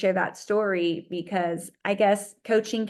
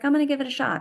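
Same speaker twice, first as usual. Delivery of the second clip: excited resolve; 7 semitones higher, much faster than usual. Retold or imitated. Decides to hire coach.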